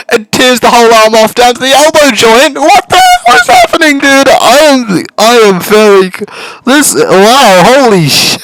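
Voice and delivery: real disbelieving shrieks with laughter; shocked. Real, not acted. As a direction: lose it completely at the twist. Shocked reaction to the game.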